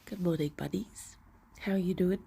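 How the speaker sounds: soft whispered good morning; whispered greeting. Real, not acted. Owner greeting her pets in a whisper. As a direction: whisper hello so as not to startle.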